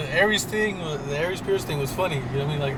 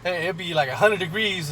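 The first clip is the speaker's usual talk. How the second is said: ordinary talk, nothing marked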